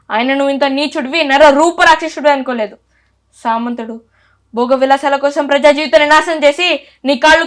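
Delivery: booming patriotic movie monologue; Alluri Sitarama Raju dialogue. Acted. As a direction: deliver it like a defiant freedom fighter on a stage. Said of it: "నా కోసం first ఒక dialogue చెప్పేసి".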